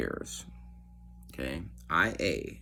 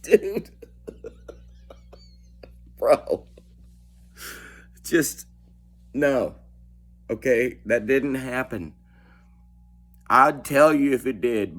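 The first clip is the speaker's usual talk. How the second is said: higher, giggling through the protest; laughing denial; 6 semitones higher, 3 dB louder than usual. Retold or imitated. Chris laughs at the investigator's claim, high and amused. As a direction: laugh it off in disbelief.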